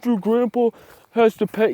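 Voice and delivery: high-pitched mock whining voice; mocking viewers. Vlogger imitating viewers who object about grandpa, mocking. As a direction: imitate a complainer in a silly high voice. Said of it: "you guys are gonna be like".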